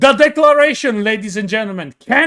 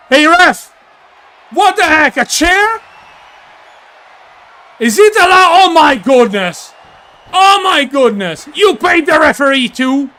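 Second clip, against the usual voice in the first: indignant outrage; 5 semitones higher than usual. Real, not acted. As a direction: shout it at the ref in disbelief. Outrage at chair being used.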